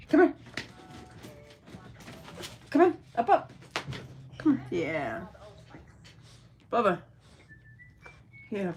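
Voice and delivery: ordinary talk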